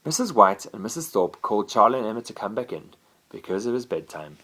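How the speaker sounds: plain reading voice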